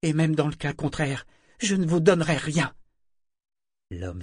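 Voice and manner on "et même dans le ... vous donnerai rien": loud, much higher furious retort; Yémin defying the broker. A narrator's reading. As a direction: spit it back in rage. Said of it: "d'un ton furieux".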